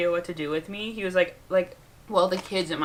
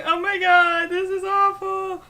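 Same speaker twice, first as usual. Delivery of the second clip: high, whiny, dramatic complaint; pitched-up wail of self-pity; dramatic whine; 10 semitones higher, 3 dB louder, slower than usual. Retold or imitated. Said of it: "and I was like".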